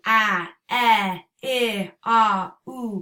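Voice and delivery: A, e, ah, oo held out as long vowel sounds; continuous vowel sounds. Shown as a demonstration. Teacher demonstrating continuous sounds to parents, held vowels. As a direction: stretch each vowel so it keeps flowing. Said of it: "Those are the sounds that".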